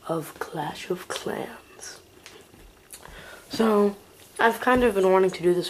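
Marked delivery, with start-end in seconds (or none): 0.1-2.0 slow dramatic whisper. Acted. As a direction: breathe the title out slowly.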